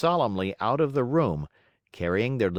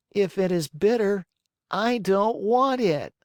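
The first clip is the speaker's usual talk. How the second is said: put-on high, stubborn little boy voice; a spoiled child refusing; whiny puppet boy; 11 semitones higher, 4 dB louder than usual. A narrator's reading. Pinocchio refusing medicine.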